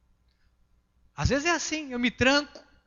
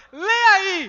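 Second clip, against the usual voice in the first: high, louder playful command; laughing retold call; 6 semitones higher, 7 dB louder than usual. Pastor telling his wife to read it, amused. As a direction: toss it out amused, half laughing. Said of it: "disse para a pastora".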